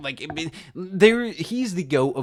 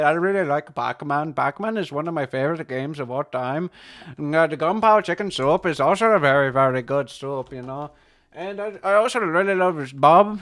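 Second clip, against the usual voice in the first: put-on Indian accent, often louder; 5 dB louder than usual. Chatting to a buddy about favorite games, Indian accent. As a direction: chat eagerly in a broad Indian accent. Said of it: "I can do an Indian accent".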